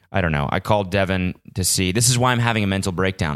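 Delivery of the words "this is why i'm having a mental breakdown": ordinary talk, nothing marked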